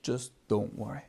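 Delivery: soft, low, calm reassurance; hushed serene comfort; completely composed. Retold or imitated. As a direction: settle his panicking friend gently. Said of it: "completely composed".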